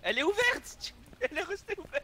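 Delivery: surprised. Real, not acted. Surprised door is open.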